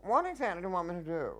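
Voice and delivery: high, fast, stagey demand; quick indignant question. Retold or imitated. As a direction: rattle off the feed line indignantly. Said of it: "But when I said".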